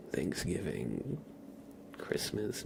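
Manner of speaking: ordinary talk, nothing marked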